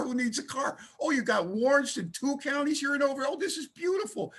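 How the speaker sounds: a sponsor overjoyed at a burned-down life; gleeful sponsor. Retold or imitated. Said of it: "He's getting happy".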